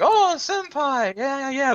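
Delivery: high, slow, dreamy swoon; swooning mascot. Retold or imitated. Imitating the bear swooning over the hero, high and slow. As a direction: sigh it out like a smitten sidekick. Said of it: "He occasionally swoons over the".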